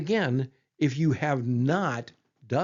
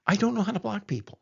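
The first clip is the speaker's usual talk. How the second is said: higher and faster, mock despair; exasperated, quick repeat; 8 semitones higher, faster than usual. Real, not acted. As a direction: throw up your hands at it. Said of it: "I must confess something to you".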